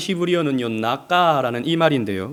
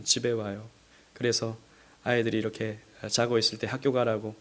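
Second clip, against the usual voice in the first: ordinary talk, nothing marked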